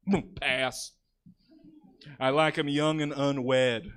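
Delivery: lightly voiced slow dragon; a hint of a bored dragon, drawn out; dragon impression. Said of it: "That was my impression of a dragon".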